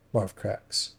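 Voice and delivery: ordinary delivery, nothing marked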